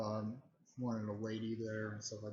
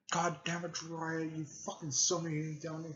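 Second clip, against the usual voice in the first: higher-pitched mock annoyance; retold gripe, voice pitched up; pissed off; 5 semitones higher than usual. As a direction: complain like an annoyed character, mildly. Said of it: "he's pretty pissed off at Jiraiya".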